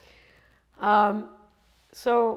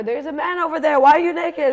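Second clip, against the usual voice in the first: raised, higher angry yell; dad yelling; 7 semitones higher, 9 dB louder than usual. Retold or imitated. Father yelling at his naked wife in front of the cab driver, loud and high. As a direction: bellow at her like an outraged dad. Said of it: "he's, like, yelling at her".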